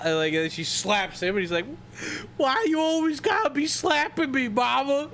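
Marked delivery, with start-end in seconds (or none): 2.4-5.1 high, wailing comic whine; hammed-up tearful protest; mock crying. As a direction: imitate a grown man bawling to his mother. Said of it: "he starts crying".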